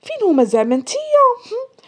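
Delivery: high, incredulous questioning; mocking questions with a lifted pitch; scornful amusement. A narrator's reading. Nesrine laughs at Sahar at the door, scornfully questioning. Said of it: "نيشين شافت فيها وضحكات".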